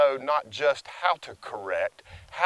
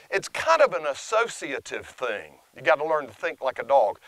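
ordinary delivery

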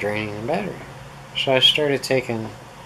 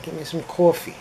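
put-on New York accent; 6 semitones higher than usual. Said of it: "I can't believe I used to talk like this".

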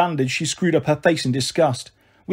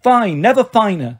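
higher, lightly overdone reassurance; insistent breeziness; 4 semitones higher, 4 dB louder, slower than usual. Acted. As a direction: insist all is well, mildly hammed-up. Marigold insists through the door.

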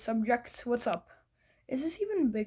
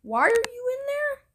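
high, loud call to the villager; 12 semitones higher, 7 dB louder than usual. Real, not acted. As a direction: shout it up at him, puzzled. Calling out to a villager.

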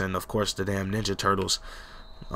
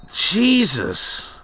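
exasperated; 9 semitones higher, 6 dB louder than usual. Real, not acted. Exasperated reaction after crack.

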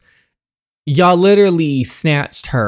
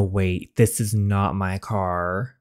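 usual speaking voice

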